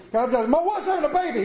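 fast panicked rush; frantic blurt. Retold or imitated. Frantic at hospital door.

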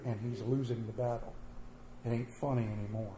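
low, soft, grave; somber. A narrator's reading. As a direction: hush it down gravely. Somber birth crisis.

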